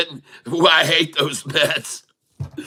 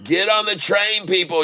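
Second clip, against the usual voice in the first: pitched up, rallying the viewers onward; excited hype; 4 semitones higher than usual. Real, not acted. Streamer urging viewers onto the rising stock, excited hype. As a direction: cheer the stock on like a train pulling out.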